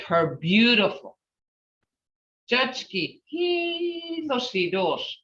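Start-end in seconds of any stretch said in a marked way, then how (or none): 2.5-5.2 softer, with hitoshi held long and high on its first syllable; emphasis on hitoshi. Shown as a demonstration. Teacher showing how to make her beautiful, hitoshi stretched high. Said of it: "You put emphasis on hitoshi".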